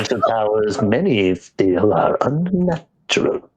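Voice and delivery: mock sinister voice for a joke about forbidden powers; ominous villain. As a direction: intone it like a dark lord luring an apprentice. Ominous movie-villain quote parody.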